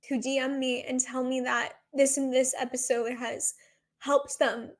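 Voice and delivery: ordinary talk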